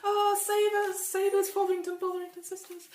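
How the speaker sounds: high, frantic cries for help; panicked screaming. Acted. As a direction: shriek for rescue in a hammed-up panic. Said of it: "they're screaming to get out".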